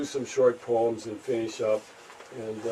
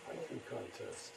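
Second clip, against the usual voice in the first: very quiet, trying out a half-remembered line; murmured to self; 19 dB quieter than usual. Real, not acted. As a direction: recall it under your breath. Recalling his haiku under his breath.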